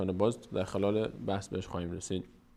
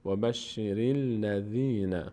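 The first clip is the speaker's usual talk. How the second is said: slow Arabic reading with held vowels; slow linked recitation; much slower than usual. Shown as a demonstration. Teacher reading the joined phrase for students, slow recitation. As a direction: recite it slowly, running r into l. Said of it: "ر میچسبه به لام".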